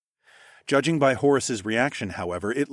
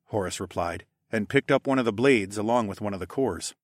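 usual speaking voice

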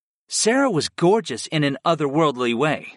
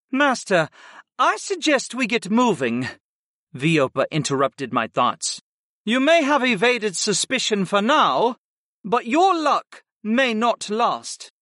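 higher-pitched succubus servant; Viopa advising the master; 8 semitones higher than usual. A narrator's reading. Succubus servant urging the master to get moving, cautioning. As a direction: caution your master in a higher female-character voice.

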